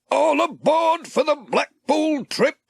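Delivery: high, slow call to everyone; shouting. A narrator's reading. Sam calling the crowd aboard the bus, high and drawn out. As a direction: sing it out like a conductor. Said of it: "shouted Sam".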